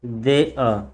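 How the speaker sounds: देयर stretched as de-a without r; silent r. Shown as a demonstration. Teacher repeating for students, देयर with silent r. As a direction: drop the r from देयर, ending low. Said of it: "इसको pronunciation क्या करते हैं".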